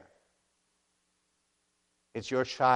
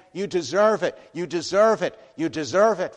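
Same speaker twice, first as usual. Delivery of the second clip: wrathful God; 7 semitones higher, 9 dB louder than usual. Retold or imitated. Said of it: "You'll hear the wrath in his voice".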